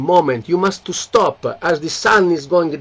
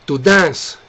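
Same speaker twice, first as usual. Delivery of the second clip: usual speaking voice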